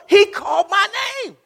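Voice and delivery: high, loud preacher's cry; soaring pulpit shout; climactic shout. Real, not acted. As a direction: cry it out at the peak of the sermon.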